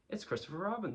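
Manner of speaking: high, eager exclamation. A narrator's reading. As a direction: announce a friend's arrival eagerly. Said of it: "said Pooh eagerly".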